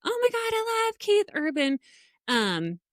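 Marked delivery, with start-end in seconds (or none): 0.0-1.8 high, squealing mock superfan; mock gushing fan. Showing the fan she is not, mock gushing. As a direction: gush like a starstruck fan, pitched way up. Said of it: "I'm not over the moon".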